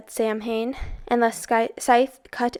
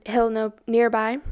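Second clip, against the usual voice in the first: plain reading voice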